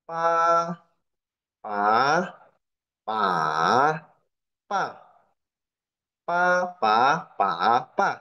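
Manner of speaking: Pa said in first, second, third, fourth tone. Shown as a demonstration. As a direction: run pa through all four tones. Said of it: "gabungin semuanya, empat-empat nadanya".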